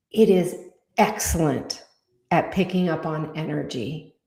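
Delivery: slow, calm spiritual guidance voice; channeled guidance. Acted.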